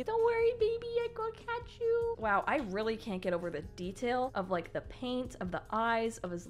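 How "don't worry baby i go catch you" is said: put-on high, babyish reassuring voice; cutesy figurine voice. Figurine promising to catch the baby, cutesy voice. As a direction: coo it like a little toy hero.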